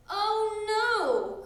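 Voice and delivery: high, loud dismayed cry; cried out. A narrator's reading. Froggy remembering his sleeping bag, crying out. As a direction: wail it in sudden dismay. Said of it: "cried Froggy".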